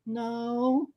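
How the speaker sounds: high, soft, drawn-out no; mock kid's answer. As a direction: answer like a clueless child. Kids answer the pitch.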